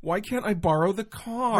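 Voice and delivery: put-on high, whiny teen complaining; whiny teenager. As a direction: whine at a parent like a sulky teen. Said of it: "but what it sounds like is like".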